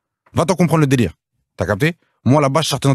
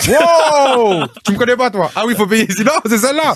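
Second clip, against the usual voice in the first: high-pitched, incredulous protest; hammed-up astonishment, pitched way up; mock outrage; 11 semitones higher, 3 dB louder than usual. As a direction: protest like a star asked to pay. Acts out his outraged reply at till.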